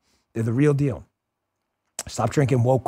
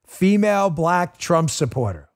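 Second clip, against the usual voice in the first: normal talking voice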